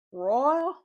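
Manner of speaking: "royal" in a loud, rising Southern-like drawl; imitated Southern-like accent. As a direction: call out "royal" like Southern family, pitch sweeping up. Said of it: "this accent".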